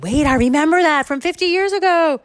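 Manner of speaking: high, loud burst from the dad; hammed-up amazed remembering; excited recollection. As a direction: exclaim it in sudden acted recognition. Dad excitedly recalls old story.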